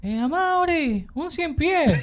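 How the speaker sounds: high, slow alarmed cry of the name; panicked call. Retold or imitated. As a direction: call out to him in a fright. Sister calling out in panic. (llamado asustado y agudo)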